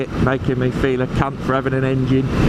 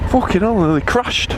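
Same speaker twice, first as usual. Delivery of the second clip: high, startled swearing; real alarm; 7 semitones higher than usual. Real, not acted. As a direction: blurt it out after a near crash. Nearly crashed while riding.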